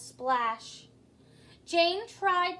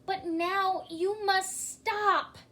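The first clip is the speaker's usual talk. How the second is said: higher, slow, firm plea; firm shout; 8 semitones higher, 4 dB louder, slower than usual. A narrator's reading. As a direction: order him to stop. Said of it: "shouted Jane".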